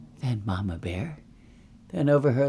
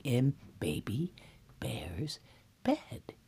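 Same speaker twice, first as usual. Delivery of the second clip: near-whisper, word by word; soft, halting suspense; hushed storytelling; 9 dB quieter, slower than usual. A narrator's reading. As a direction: creep up on it in a hush. Narrator creeps up on sleeping girl.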